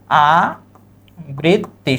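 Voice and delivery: আবৃত্তি drawn out very slowly, each sound clear; slow model pronunciation. Shown as a demonstration. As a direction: stretch আবৃত্তি out syllable by syllable. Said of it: "সঠিক উচ্চারণ কি?".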